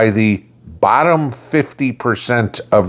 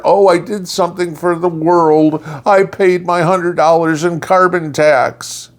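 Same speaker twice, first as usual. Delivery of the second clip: higher, self-satisfied mock do-gooder; smug taxpayer; 9 semitones higher than usual. Mocking carbon tax payers, smug higher mock voice. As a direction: play the pleased taxpayer patting himself on the back. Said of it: "make you feel better".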